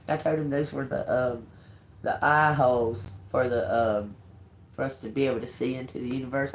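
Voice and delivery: normal talking voice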